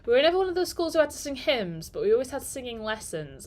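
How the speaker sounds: ordinary talk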